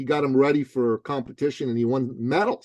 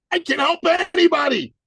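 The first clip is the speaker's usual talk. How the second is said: high, loud eager voice; eager trainer voice; 4 dB louder than usual. Retold or imitated. Trainer Dan boasting to his coach, high eager voice. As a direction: squeak it out like a cocky young trainer. Dan quoted pitching himself.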